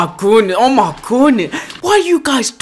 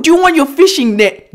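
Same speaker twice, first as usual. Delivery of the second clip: ordinary talk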